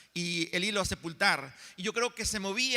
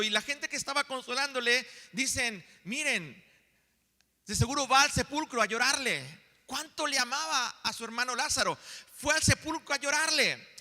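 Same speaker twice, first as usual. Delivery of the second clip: raised, insistent pulpit voice, pressing each phrase; building sermon intensity; fervent preaching; 5 semitones higher than usual. Acted.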